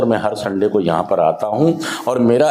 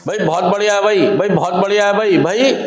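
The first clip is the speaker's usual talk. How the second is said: higher, hammed-up gushing over a buddy; fake praise; 8 semitones higher than usual. Counsellor showing addicts how to fake praise, mock gushing. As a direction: lay on mock flattery, bhai. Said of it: "नकली करने लग जाओ".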